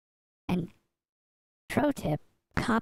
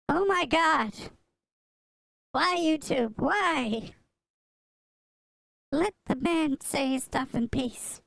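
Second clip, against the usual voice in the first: high, exasperated plea; exasperated outburst; 7 semitones higher than usual. Real, not acted. Streamer pleading with YouTube over deleted comments, exasperated. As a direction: wail in protest at the unfairness.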